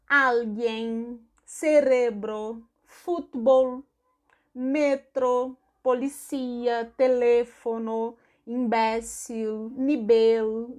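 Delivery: each word slow with the Spanish stressed syllable. Shown as a demonstration. Teacher drills students on Spanish stressed syllables, slow. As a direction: spell out teléfono, imbécil, nivel slowly with Spanish stress. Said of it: "eles vão dizer".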